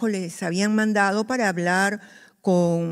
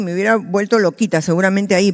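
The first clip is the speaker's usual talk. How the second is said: normal talking voice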